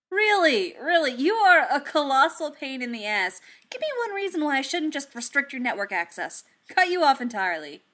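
high, loud exasperated tirade; loud rant. A narrator's reading. Tony scolding the bot across the room, loud rant. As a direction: keep shouting, fed up with the robot. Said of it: "Tony yelled across the workshop".

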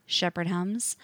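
usual speaking voice

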